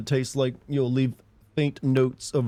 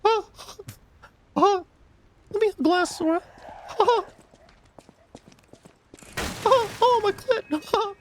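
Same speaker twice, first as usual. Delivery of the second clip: very high, squeaky cartoon falsetto; Mickey. Mickey wanting a glass from Sora, squeaky falsetto. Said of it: "If you did a Mickey impression".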